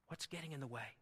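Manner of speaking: normal talking voice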